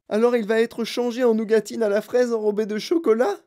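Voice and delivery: plaintif, plus aigu; lamenté. Acted. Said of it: "se lamenta madame Gloop". (la mère se lamente, aigu et plaintif)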